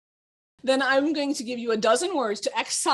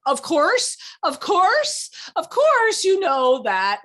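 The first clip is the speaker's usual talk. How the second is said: smug mock; 7 semitones higher, 6 dB louder, slower than usual. Shown as a demonstration. Mocking smug "of course.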